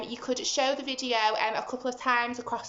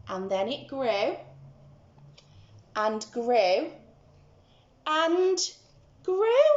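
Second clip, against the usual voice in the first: building excitement; 2 semitones higher, 4 dB louder, slower than usual. A narrator's reading. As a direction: grow the voice with the beanstalk. Building growth.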